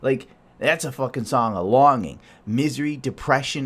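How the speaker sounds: ordinary talk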